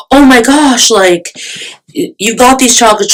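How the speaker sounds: high-pitched imitation of a begging friend. Friend begging for the souvenir chocolates, mock begging. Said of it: "She's begging me".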